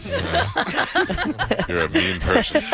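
laughing hard. Real, not acted. Host cracks up at remark.

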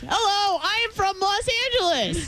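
a hint of a high, mock Los Angeles voice; mock LA accent. Asked how the mom sounds, a mock Los Angeles accent. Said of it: "Like this".

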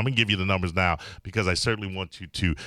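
brisk radio host voice; radio host read. Acted. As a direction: read the call-in line like an announcer.

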